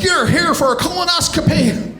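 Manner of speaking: high, sing-song mock yelling. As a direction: nurses scolding a patient, sung high with a laugh. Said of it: "yelling at him".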